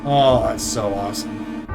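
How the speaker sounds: ordinary delivery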